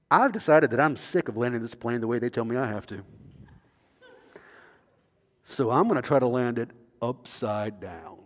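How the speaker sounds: low, soft captain on the intercom; calm pilot announcing descent; airline pilot voice. Retold or imitated. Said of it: "the pilot comes on the radio".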